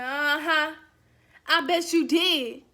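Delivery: loud, slow, knowing disbelief; slow, loud, mocking "uh-huh"; sarcastic. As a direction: draw it out, not buying it.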